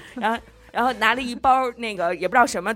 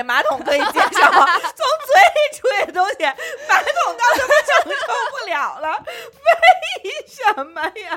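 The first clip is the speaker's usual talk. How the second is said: laughing through the words, voice climbing high; can't stop laughing while mocking; 13 semitones higher, 4 dB louder, faster than usual. Real, not acted. Mocking clean-freak logic, laughing.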